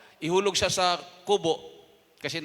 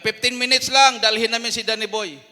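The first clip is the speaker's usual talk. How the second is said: loud announcement; 7 semitones higher, 11 dB louder than usual. Retold or imitated. Captor announces taking him.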